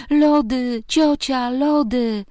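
put-on whine-yell each word like a stubborn five-year-old; insistent high childish chant; child's tantrum. A narrator's reading. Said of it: "ryczał na całego".

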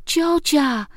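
put-on high, insistent little-boy yell; voiced five-year-old's tantrum cry; child yelling. A narrator's reading. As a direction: holler for auntie like a furious small child. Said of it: "wrzeszczał".

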